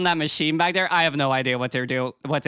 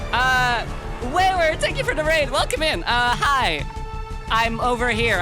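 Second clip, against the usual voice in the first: high bright streamer greeting; cute anime streamer; 6 semitones higher than usual. Acted. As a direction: bubbly welcome to raiders. Welcoming raiders.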